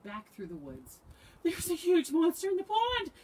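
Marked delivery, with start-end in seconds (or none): 1.4-3.1 high, loud cry of alarm; frightened cry. A narrator's reading. Frog warns his friends of a monster, crying out. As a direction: shout the alarm at a high pitch. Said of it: "he cried".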